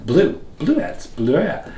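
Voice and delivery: "bleuets" carefully shown in French; French pronunciation. Shown as a demonstration. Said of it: "in French they're bleuets".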